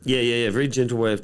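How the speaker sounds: usual speaking voice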